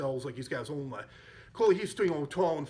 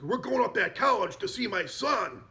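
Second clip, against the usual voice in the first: higher-pitched, mildly hammed-up rallying call; rousing announcement; 7 semitones higher, 4 dB louder than usual. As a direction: imitate a man rallying his driver. Acted rallying announcement to Lou.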